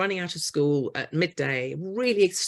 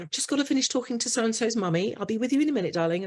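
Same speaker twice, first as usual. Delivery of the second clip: distracted parent; 7 semitones higher, faster than usual. Busy parent putting child off.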